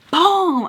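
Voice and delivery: loud, high "boom" sound effect; sudden booming impact noise; imitated hit. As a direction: punch the word out like a blow landing. Imitated blow in retold fight.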